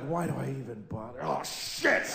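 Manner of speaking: dejected mutter. Acted. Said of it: "walking to work like".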